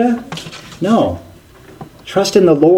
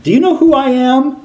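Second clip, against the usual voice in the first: much higher, affronted mock demand; indignant Naaman; 12 semitones higher than usual. Naaman snubbed by the prophet, mock indignant demand. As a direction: pitch it up like an insulted VIP. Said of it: "you can almost hear Naaman saying".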